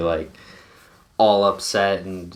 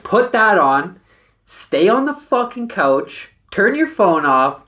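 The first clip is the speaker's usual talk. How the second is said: much higher, louder slow command; exasperated orders; 10 semitones higher, 5 dB louder, slower than usual. Retold or imitated. Friend telling drunk buddy what to do, loud high orders. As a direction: bark the order, fed up.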